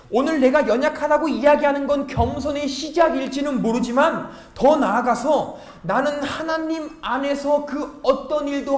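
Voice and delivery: loud, driving sermon delivery; preaching at full force. Acted. Pastor urging the youth congregation, preaching loud and strong. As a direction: hammer the point home to the congregation. Preacher drives home humility point.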